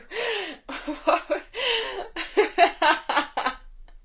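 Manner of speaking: bursts into high, helpless laughter; real laughter. Real, not acted. Presenter laughing at a viewer's cheeky comment, high giggle. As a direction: cracks up mid-live at a viewer's comment.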